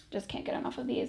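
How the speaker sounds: breathy whispered aside; whispering. Real, not acted. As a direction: whisper it to yourself. Aside while sipping Topo Chico.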